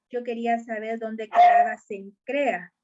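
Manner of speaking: ordinary delivery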